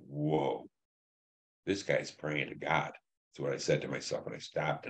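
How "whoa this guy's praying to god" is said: awed hush. Retold or imitated. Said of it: "I'm like".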